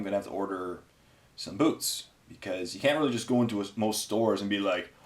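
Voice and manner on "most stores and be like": ordinary talk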